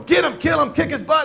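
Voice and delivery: high, loud ringside yelling; wife and kids cheering. Acted. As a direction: egg the fighter on from the crowd. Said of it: "hear my wife and kids going".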